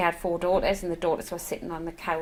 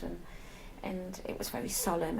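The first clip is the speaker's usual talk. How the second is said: usual speaking voice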